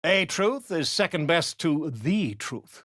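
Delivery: ordinary talk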